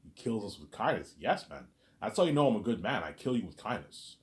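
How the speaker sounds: normal talking voice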